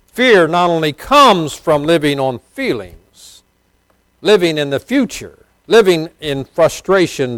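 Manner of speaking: high, loud pulpit cry, slowed on key words; fervent preacher lifting his voice high and loud; full-force preaching. Acted. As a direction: preach it out to the back row.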